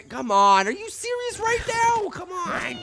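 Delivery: very high-pitched indignant whining; exasperated gamer outburst. Real, not acted. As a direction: protest in a squealing high voice. Losing at the game.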